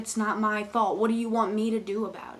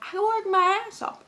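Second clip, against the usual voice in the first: indignant insistence; 11 semitones higher, 3 dB louder than usual. Real, not acted. Cashier refusing to pay for customers, indignant. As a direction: push the pitch right up in protest.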